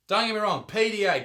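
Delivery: usual speaking voice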